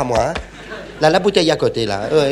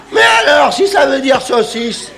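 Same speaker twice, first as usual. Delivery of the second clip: indignant shout; 7 semitones higher than usual. Acted. Diner protesting to his friend, indignant shout. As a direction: protest at the English on the menu.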